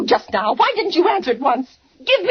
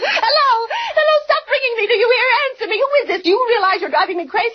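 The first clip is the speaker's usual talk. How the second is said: panicked pleading; 7 semitones higher than usual. Acted. Frightened woman shrieking at the silent caller. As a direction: beg the phone to stop, near hysteria. Terrified caller keeps ringing.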